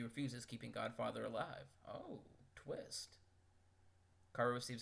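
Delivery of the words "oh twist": whispered surprise. Real, not acted. Surprised aside.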